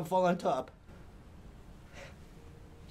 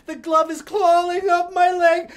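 ordinary delivery, nothing marked

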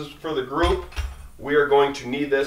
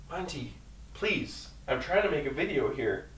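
quietly pleading with dog; 10 dB quieter than usual. Real, not acted. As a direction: hush the dog gently.

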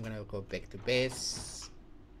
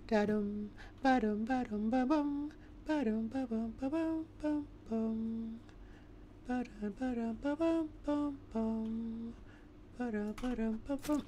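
tumbling down stairs sound; 11 semitones higher than usual. Pretend. Hums a sound while crawling.

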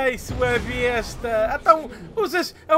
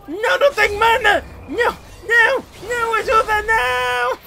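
higher, louder desperate no's; panicked cries; 8 semitones higher, 5 dB louder than usual. Real, not acted. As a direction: beg the game to stop. Panique en plein combat perdu.

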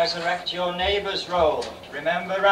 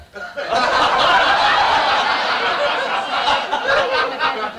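high breathy villain cackle; evil cackle; 11 semitones higher, 3 dB louder than usual. Acted. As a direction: cackle like a panto baddie. Villain laugh in panto.

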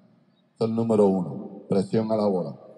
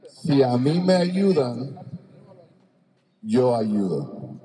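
slow emphatic; 2 semitones higher, slower than usual. Shown as a demonstration. Coach voicing the defense's teaching motto.

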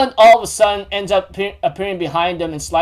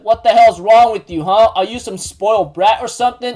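louder, raised retold telling-off; indignant scolding; 4 semitones higher, 5 dB louder than usual. Jericho confronts Elaine after being pushed aside, indignant. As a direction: act out an exasperated challenge.

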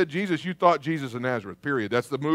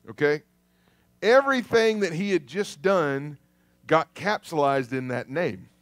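ordinary talk, nothing marked

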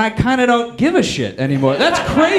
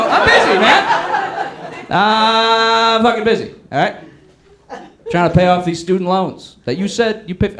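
mock annoyed; 3 semitones higher than usual. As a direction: brush him off like a pest. Snapping back at president.

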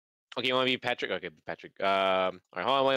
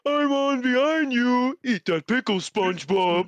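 peer-pressure him like Patrick; lightly done cartoon starfish goading SpongeBob; 5 semitones higher than usual. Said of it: "sounds like SpongeBob is on".

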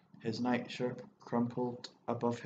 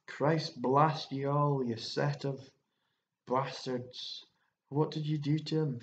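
raging curse; 3 semitones higher, 4 dB louder than usual. Acted. Woman cursing in rage.

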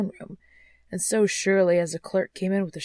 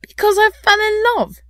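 high, loud exclamation; impassioned, high-pitched answer; ardent; 12 dB louder than usual. A narrator's reading. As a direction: declare it with youthful fervour. Nephew answers uncle ardently.

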